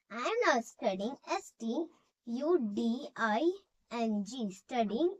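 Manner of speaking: very slow, word by word, for students to write; slow dictation. Shown as a demonstration. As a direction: spell it out slowly so the class can copy. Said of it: "dictation and spelling".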